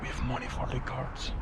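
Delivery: hushed whisper, sneaking past a dog. Real, not acted. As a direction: whisper so the guards won't hear.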